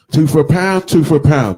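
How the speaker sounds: loud, high stallholder cry; mock market trader hawking his fruit; market trader call. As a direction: shout it across a market stall. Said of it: "Market trader".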